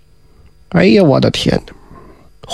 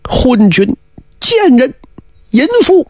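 each insult climbing higher in pitch; escalating insults; 6 semitones higher than usual. Acted. Jia cursing the tyrant and his consort, climbing angry cries. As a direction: hurl the words, rising in outrage.

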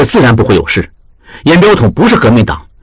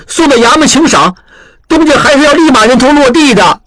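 higher, pressing voice of a worried apprentice; anxious young apprentice; 8 semitones higher than usual. A narrator's reading. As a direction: pour out his fears in one breath. Apprentice explains the danger.

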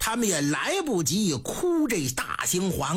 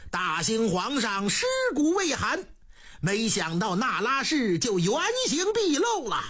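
ordinary delivery, nothing marked

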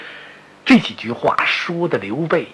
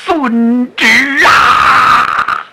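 long, slow, high and loud acceptance cry; opera-style drawn-out bellow of obedience; drawn-out shout; 8 semitones higher, 4 dB louder, much slower than usual. Acted. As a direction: stretch it out like a bellowed battle oath. Zhang Fei accepts the order.

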